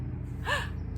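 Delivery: breathy rising gasp of delight; excited gasp. Acted. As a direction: suck in air in wonder. Excited gasps between lines.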